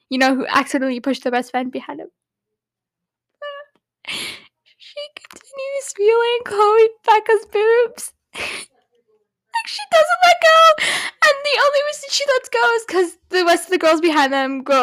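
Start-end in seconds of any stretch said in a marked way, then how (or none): 4.8-14.8 excited squeal. Real, not acted. Excited gossip about the scene.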